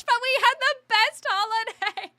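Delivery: high, loud laughing punchline. Real, not acted. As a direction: burst out laughing at the irony. Laughing punchline after sad story.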